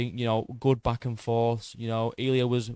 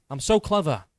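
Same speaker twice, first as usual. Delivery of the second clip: cocky, pleased with himself; smug boast; 4 semitones higher than usual. Real, not acted. Creator bragging to viewers about his prediction, smug. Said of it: "stop tooting my own horn".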